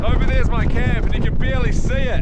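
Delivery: high, projected voice over the storm. Real, not acted. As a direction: call it out over the wind and snow. Reporting outside in a blizzard.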